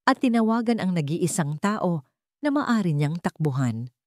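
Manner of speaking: ordinary delivery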